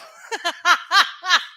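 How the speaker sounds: a loud, high burst of real laughter. Real, not acted. Streamer cracking up at her husband's voice prank, loud laugh.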